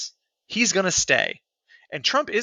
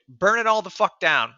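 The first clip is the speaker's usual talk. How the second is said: normal talking voice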